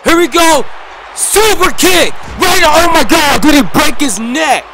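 high, loud hype shout; excited shouting. Real, not acted. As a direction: yell it like a ringside commentator. Live wrestling match commentary peak.

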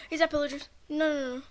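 loud sudden scared no; alarmed cry at possible enemies; startled alarm. Real, not acted. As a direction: jolt up in fright. Spots possible pillagers.

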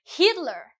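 Hitler said with the H clearly breathed; H sounded. Shown as a demonstration. Teacher showing the class an H kept from German. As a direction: keep the H on Hitler. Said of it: "We don't say Hitler, we say Hitler too".

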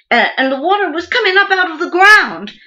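high, loud, panicked telling; alarmed. Acted. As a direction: relive the shock of the rising flood. Recounting water surging from the ground.

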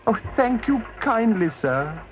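high, weak put-on old woman voice; feeble voice. Toad in disguise thanks the gentleman, feeble voice. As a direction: Toad faking a frail washerwoman, thanking gently. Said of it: "said Toad in a feeble voice".

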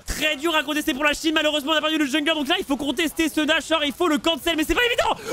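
high-pitched urgent esports call; excited play-by-play. Real, not acted. Caster following a contested Nashor fight, excited.

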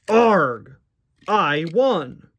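drawn-out storybook exclamation; hammed-up racer's shout; acted outburst. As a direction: cry out at the finish line. Acted race finish in story.

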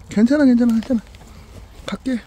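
high, insistent pet reassurance; reassuring a dog. Real, not acted. Owner reassuring the dog before leaving, high-pitched comforting.

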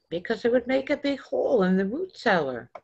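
put-on child explaining the root cellar; lightly voiced child. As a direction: hurry it like an anxious kid.

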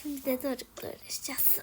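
tired, hushed whisper. Acted. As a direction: whisper aside to the camera. Anne kameraya fısıldıyor. (yorgun fısıltıyla)

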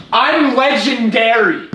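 slow, loud, high boast; triumphant boast. Real, not acted. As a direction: drag out the brag. Boasting after the win.

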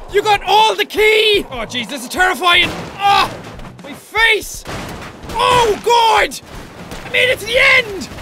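ordinary talk, nothing marked

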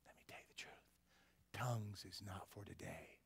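secretive whisper. Retold or imitated. Baptist dean telling the young student the truth, whispering.

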